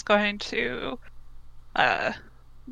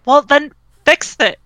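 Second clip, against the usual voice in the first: loud, higher, exasperated demand; irritated raised voice; angry order; 5 semitones higher, 9 dB louder than usual. Acted. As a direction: snap at Dad to sort it out. Acted quarrel with father.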